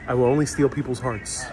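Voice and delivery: usual speaking voice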